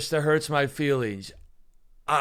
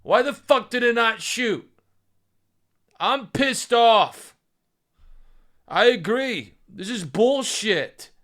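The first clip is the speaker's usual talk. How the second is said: loud, high, exasperated yelling at the misfire; drawn-out angry rant at a dud gun; frustrated outburst; 6 semitones higher, 4 dB louder, slower than usual. Real, not acted. Gun failed to shoot, streamer frustrated.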